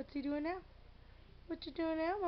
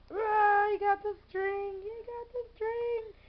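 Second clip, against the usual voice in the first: excited cheer; 6 semitones higher, 6 dB louder than usual. Real, not acted. Cheering cat's catch.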